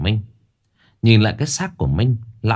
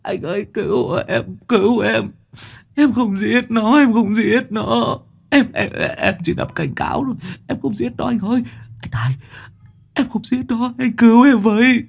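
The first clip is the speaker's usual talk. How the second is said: crying plea; 13 semitones higher than usual. Acted. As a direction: wail for rescue like a terrified boy. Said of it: "Thằng Duy vẫn cứ khóc lóc".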